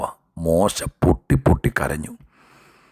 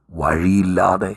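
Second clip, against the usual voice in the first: ordinary delivery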